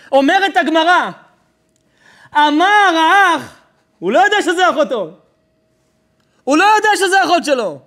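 loud, high, impassioned cry; full-force preaching. A narrator's reading. Preacher telling of the unknowing siblings, crying out at full force.

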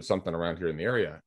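ordinary talk

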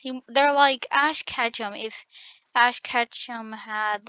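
normal talking voice

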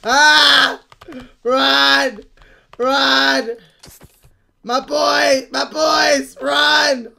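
loud calls to run; rally the team to flee; urgent shouts. Real, not acted. Gamer yelling at teammates to flee, urgent.

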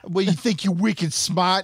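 high-pitched Boston accent. Shown as a demonstration. Modelling it for co-host, high Boston accent. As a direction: show them how a Bostonian says it. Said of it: "so good at a Boston accent".

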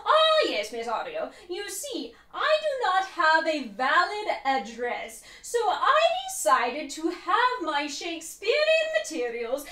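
theatrical, swooping stage delivery; hammy Shakespearean actor. Acted. Student explains the acting course to teacher, hammed-up Shakespearean. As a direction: draw out key words like a grand thespian. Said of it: "taking a Shakespearean acting course".

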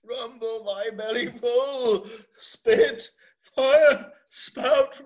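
high, loud raging cries; furious shout. Acted. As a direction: hurl each word up at the storm. Lear shouting at the storm.

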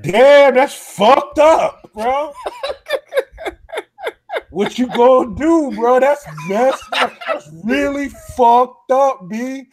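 milk it like a guy loving the drama; hammed-up guy in line. Guy behind him in line teases, hammed-up mock sympathy. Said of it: "He putting all the extra on it".

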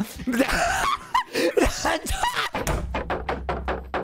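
high unvoiced shriek of laughter. Real, not acted. Streamer cracking up at his friend, shrieking laugh. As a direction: burst out in a screaming laugh.

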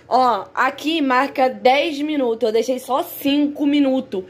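ordinary delivery

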